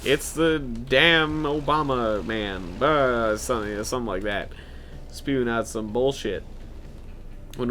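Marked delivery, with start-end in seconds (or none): 0.0-2.8 slow, dragged excuse; slow drawn-out excuse. Retold or imitated. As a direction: drag it out lazily. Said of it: "And be like".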